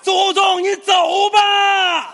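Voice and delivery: very high, wailing holler; full-volume shout. Acted. Patient's final plea to his ancestors, wailing shout. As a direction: bellow it, stretching the last word out. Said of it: "第二声，大声。".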